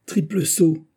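sharp, high furious cry; furious outburst. Acted. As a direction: spit it out in a rage. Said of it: "répliqua Colbert furieux".

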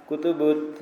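"kutubut" with a u in every syllable; wrong vowelling. Shown as a demonstration. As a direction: misread it as "kutubut", all u vowels. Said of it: "Jangan dibaca".

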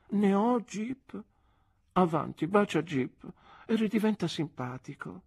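put-on high playful girlish voice; Dora coaxing. A narrator's reading. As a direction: coax him sweetly, pitched high. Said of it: "disse Dora". (voce alta e civettuola da ragazza)

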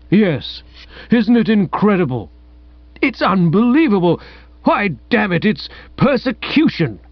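put-on excitable old magistrate, pitched high and rising; old Bassett voice. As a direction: sputter like an outraged old magistrate.

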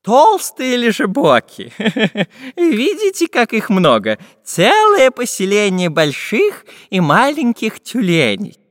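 high, loud geographer with a chuckle; amused geographer. A narrator's reading. Geographer showing fat seals to the travellers, amused and high. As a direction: show off the sight, drawing out words.

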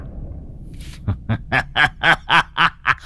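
high, gloating villain cackle; long staccato sinister laugh; evil laugh. Acted. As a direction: laugh in triumph at his shock.

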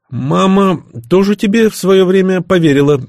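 high, loud snide jab. A narrator's reading. Son throws mother's trust back at father, snidely. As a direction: needle him, voice pitched right up. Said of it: "ехидно заметил я".

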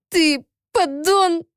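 high, slow, wounded cry; slapped woman lashing out, near tears; tearful outburst. A narrator's reading. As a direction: spit it through tears.

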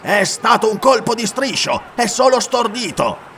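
excited insistence; urgent explanation. Acted. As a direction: reassure the crowd heatedly. Corinthian explains the blow.

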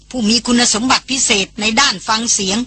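ordinary delivery, nothing marked

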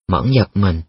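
usual speaking voice

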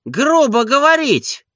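very high, indignant squawk; high, loud bursts of offended questioning; outraged master. A narrator's reading. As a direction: haul the servant over the coals. Offended master confronts rude servant.

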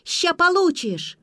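very high, loud, slow shrieked threat; shrill screaming threat; piercing shriek. A narrator's reading. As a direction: scream it with all your lungs. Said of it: "пронзительно завизжала".

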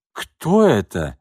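slow stunned exclamation; shocked. Acted. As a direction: blurt it in shock. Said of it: "потрясённо воскликнул он".

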